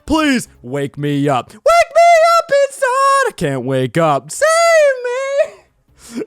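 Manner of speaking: very high, loud pleading wail; hammed-up spooky wail; wailing ghost. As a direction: beg to be woken, crying out. Voicing edited ghost text.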